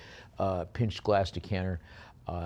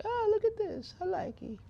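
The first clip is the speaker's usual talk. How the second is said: very high falsetto bather voice; bather in painting; 3 dB quieter than usual. Acted. Auctioneer voicing the painted bather admiring things, very high falsetto. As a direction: squeak it out as the delighted bather. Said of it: "The bather's like".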